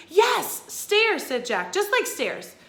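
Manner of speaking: high eager yes, loud; excited child. Acted. Kid confirming the guess to the Ghost Queen, excited. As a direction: blurt it out like a thrilled kid.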